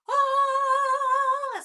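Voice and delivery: high, loud sung yelp of relief; a sudden high sung "ah". Retold or imitated. Outburst at finding matchbook.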